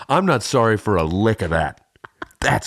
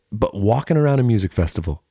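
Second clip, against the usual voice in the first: ordinary delivery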